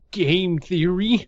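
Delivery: slow, high and weak; weak stammer. Acted. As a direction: stammer it out feebly. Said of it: "stammered out a weak".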